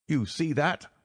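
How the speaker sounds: a frightened man checking the strangers; nervous reedy man. A narrator's reading. Nervous patient voiced.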